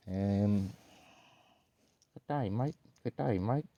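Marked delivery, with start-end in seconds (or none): 2.1-3.7 Australian greeting with rising ends; Australian accent. Pretend. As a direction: rehearse an Aussie hello, lifting the end. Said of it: "Isabella from Australia".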